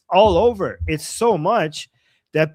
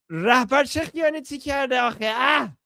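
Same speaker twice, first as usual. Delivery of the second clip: high, fast indignant yell; angry shout; 6 semitones higher, much faster than usual. Retold or imitated. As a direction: demand it furiously. Acting angry comment, shouted.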